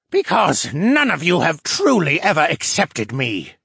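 snarled. A narrator's reading. Captive merman never accepted by his people, snarling. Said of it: "the fisherman snarled".

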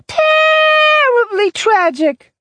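drawn out, very high and slow; slow dramatic wail. A narrator's reading. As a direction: stretch it out tragically. Simi drawls dramatically.

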